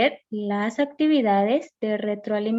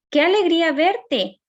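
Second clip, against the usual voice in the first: bright, raised, delighted greeting; joyful exclamation; 6 semitones higher, 4 dB louder than usual. Shown as a demonstration. Teacher demoing an exclamation for her students, delighted. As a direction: greet an old friend you're thrilled to see. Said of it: "Los signos de interrogación y de exclamación".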